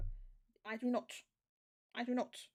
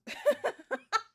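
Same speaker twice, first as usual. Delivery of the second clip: burst of laughter; 12 dB louder than usual. Real, not acted. Laughs at the translator's result.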